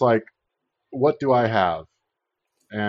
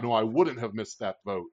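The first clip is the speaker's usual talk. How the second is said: usual speaking voice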